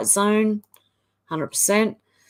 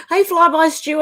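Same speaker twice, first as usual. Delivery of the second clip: excited greeting; 7 semitones higher, 6 dB louder than usual. Real, not acted. Spots a viewer while scrolling.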